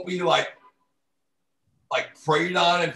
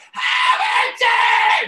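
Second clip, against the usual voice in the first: high, slow screeching yell; barking crow caller; 7 dB louder, much slower than usual. Retold or imitated. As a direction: squawk his name like a crow. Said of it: "that barking fucking crow broad".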